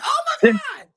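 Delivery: mock scream remembering the butterfly; terrified shriek. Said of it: "I wasn't like, "Yay." I was more like".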